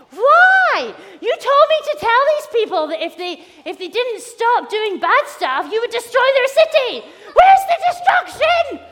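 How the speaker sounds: high, loud furious tantrum; acted outraged shouting, shrieked peaks; raging. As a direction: rant at the top of your voice. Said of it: "raging. He is so grumpy".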